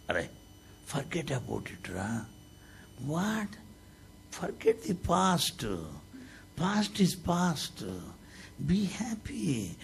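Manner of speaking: slow, soft and kind; gentle consoling. Retold or imitated. Baba comforting a weeping devotee, slow and gentle. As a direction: soothe him slowly like a child.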